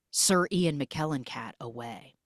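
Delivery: normal talking voice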